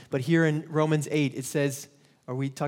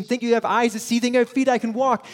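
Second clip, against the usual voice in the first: prayer swelling higher and louder; fervent thanks; 7 semitones higher, 5 dB louder, faster than usual. Shown as a demonstration. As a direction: let the thanks burst out, raised and loud. Prayer rising to fervour.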